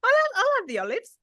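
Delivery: high-pitched mock "I'm fine"; put-on reassurance. Telling co-host how they insisted the olives were fine, high mock reassurance. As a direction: retell it in a high, squeaky, unconvincing voice. Retold reluctant reply about the olives.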